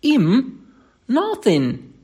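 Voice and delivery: high, emphatic Cockney retort; lightly scornful Cockney; Cockney dialect. Acted. Thomas dismisses the watchman to his employer, emphatic Cockney. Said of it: "Thomas' Cockney dialect".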